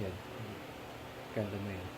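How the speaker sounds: ordinary delivery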